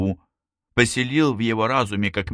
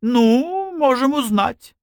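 very high, louder, offhand shrug; feigned indifference; 4 dB louder, slower than usual. A narrator's reading. Sarai shrugs back at his idea, with put-on indifference. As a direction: pitch it way up, pretending not to care. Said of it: "Тоже изобразив равнодушие".

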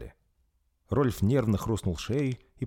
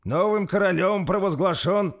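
higher, louder solemn announcement; proclamation; 12 semitones higher, 6 dB louder than usual. A narrator's reading. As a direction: proclaim the new king. Gardi announces. (торжественное объявление)

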